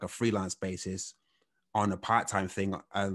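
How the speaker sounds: normal talking voice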